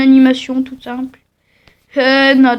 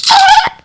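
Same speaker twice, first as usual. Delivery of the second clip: short shrill shriek; high scream; 6 dB louder than usual. Acted. Character screams.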